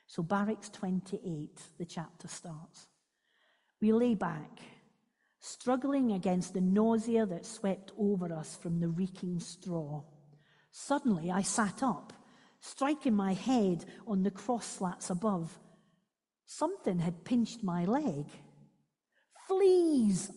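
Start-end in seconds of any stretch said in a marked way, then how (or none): 3.8-18.3 expressive read-aloud of a memoir; story reading. Acted. Speaker reads Corrie ten Boom's memoir to listeners, expressive read-aloud. Reads book extract to congregation. 19.5-20.3 a sudden high, loud cry. Acted. Corrie discovers fleas in the barracks, high loud cry. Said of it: "I cried".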